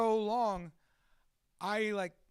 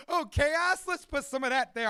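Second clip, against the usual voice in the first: loud, high, hammed-up eagerness; gleeful chaos-lover act; 7 semitones higher, 9 dB louder than usual. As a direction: welcome trouble with exaggerated delight. Acts out welcoming chaos gleefully.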